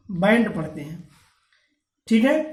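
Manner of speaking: usual speaking voice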